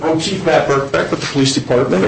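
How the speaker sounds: ordinary delivery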